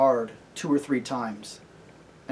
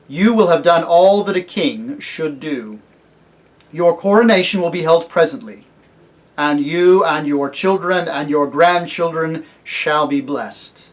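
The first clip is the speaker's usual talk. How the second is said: loud, stately blessing; solemn kingly lion; 3 semitones higher, 9 dB louder than usual. Acted. Aslan promising the cabbie a coronation, solemn. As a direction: pronounce it with majestic certainty.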